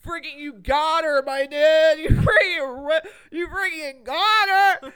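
loud high bro voice, laughing. Acted. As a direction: hype frat bro praise. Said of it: "doing the bro voice".

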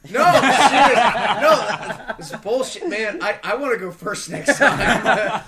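long loud high "no", then griping; loud protest. Real, not acted. Podcaster robbed of their pick, loud laughing complaint. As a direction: complain loudly with laughter around it.

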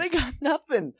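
high, laughing through it. Retold or imitated. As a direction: echo the line while laughing. Echoing the same quote.

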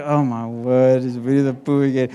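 weary dad. Retold or imitated. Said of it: "I'm like".

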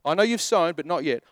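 normal talking voice

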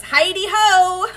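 high, slow, laughing nerdy hello; goofy greeting. Retold or imitated. Host imitating Melvin's goofy greeting, high and slow. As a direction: stretch the greeting out like a dorky classmate. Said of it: "Melvin shows up and goes".

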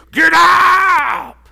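high, drawn-out raspy shout; loud impression of an old trainer; old coach yelling. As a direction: roar it like an old boxing coach. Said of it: "like the old coach looking at you".

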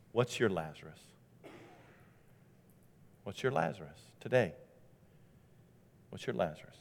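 low, soft, searching question with long pauses; quiet challenge. Real, not acted. Preacher asking listeners to examine themselves, quiet challenge. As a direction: drop low and soft, let each question hang.

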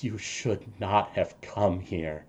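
grieving father whispering through tears; father mourning his son, quietly crying. Acted.

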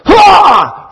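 high loud "Ha!" right in the face; playful scare yell, high and loud; jump-scare shout. Retold or imitated. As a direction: burst out to scare your brother. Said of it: "like that".

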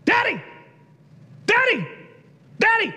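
put-on high, repeated child's cry of Daddy; little son calling out. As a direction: burst out like an excited six-year-old. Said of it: "he just, he does this".

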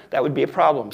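ordinary delivery

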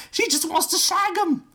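high put-on girly voice, teasing; high mock voice. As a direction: squeal it in a silly high voice. Fan fiction read aloud, quoted mock.

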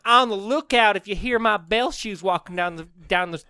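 high southern twang, stumbling through giggles; mock threat in a hammed-up Texas accent; Phantom Bell man. Ghost bellman threatening hotel guests, in a southern accent.

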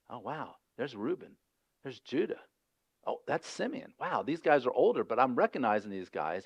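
name each brother under your breath; inner recognition. Retold or imitated. Joseph quietly picking out his brothers, soft and wondering.

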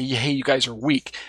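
ordinary talk

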